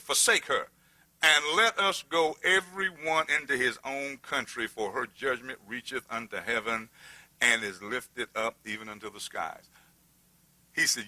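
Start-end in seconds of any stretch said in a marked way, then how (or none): 0.0-9.7 hushed solemn reading; scripture reading. Acted. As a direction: intone it with reverence. Reads scripture aloud.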